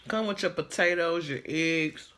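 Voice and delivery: ordinary talk, nothing marked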